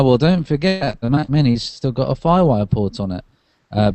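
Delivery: normal talking voice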